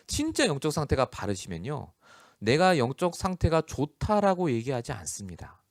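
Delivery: ordinary delivery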